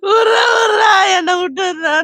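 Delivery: loud, tearful shout to let her go; sobbing yell, fading at the end; reenacted scream. Robbed woman reliving her scream at the attackers, crying and loud. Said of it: "நான் கத்துறேன்".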